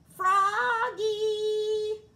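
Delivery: high, loud sung call; mother calling her son from another room. A narrator's reading. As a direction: call him from downstairs. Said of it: "called his mother".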